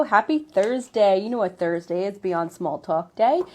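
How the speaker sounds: normal talking voice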